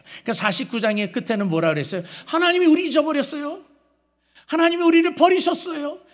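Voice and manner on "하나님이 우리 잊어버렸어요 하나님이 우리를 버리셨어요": much higher, whiny complaint; complaining Zion. Retold or imitated. Preacher voices Zion complaining to God, high and whiny. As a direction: whine like a hurt child accusing God. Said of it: "라고 불평을 하니까".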